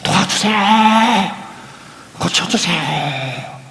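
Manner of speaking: desperate drawn-out cry for help; acted shouting. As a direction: call out slowly, stretching the last syllables. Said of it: "예수님께 소리를 지르는 겁니다". (절박하게 길게 외치는 흉내)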